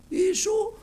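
high call. Acted. Beggar calling to Jesus as he passes, high call. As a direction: call his name across the crowd. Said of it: "Φώναζε δυνατά".

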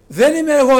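high, loud, ringing declaration; loud quoted denial. Acted. Preacher voicing John loudly to the congregation, loud declaration. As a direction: cry it out as the humble prophet. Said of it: "λέγει ο Χριστός".

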